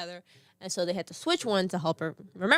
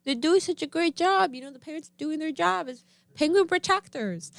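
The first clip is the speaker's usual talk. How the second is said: coaxing big sister; 7 semitones higher than usual. Retold or imitated. Big sister soothing toddler about penguins, high upbeat coaxing. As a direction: talk a scared child round with praise. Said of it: "I was like".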